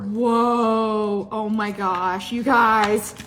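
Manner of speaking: loud, drawn-out amazement; blown-away exclamation; amazed. Real, not acted. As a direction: stretch it out in loud wonder. Amazed at new butter slicer.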